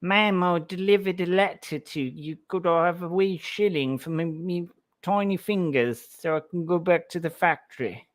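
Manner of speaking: lightly voiced Cockney urchin begging for a shilling; a hint of a Victorian Cockney errand boy pleading; Cockney delivery boy. Cockney delivery boy begging the lady for a shilling, light pleading. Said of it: "wild impressions of, uh, of delivery boys".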